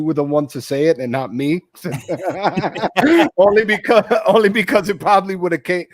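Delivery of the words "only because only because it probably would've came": higher, louder words through laughter; laughing. Real, not acted. Co-host admitting he's glad the other said it, laughing. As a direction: break into laughter while explaining. Laughing while agreeing on bias.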